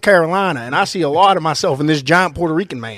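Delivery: loud mock Carolina accent; Jeff Hardy. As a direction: ham up a Southern Carolina accent. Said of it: "How do you like my Carolina accent".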